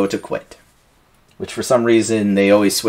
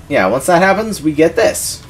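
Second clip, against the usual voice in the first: usual speaking voice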